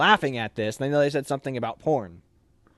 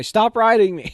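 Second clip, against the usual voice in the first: mock plea to family; 9 semitones higher, 9 dB louder than usual. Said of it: "they shoulda just wrote his family".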